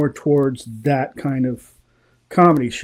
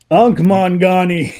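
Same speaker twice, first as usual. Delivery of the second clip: higher, louder cartoon jungle call; Tarzan cartoon call; 5 semitones higher, 5 dB louder than usual.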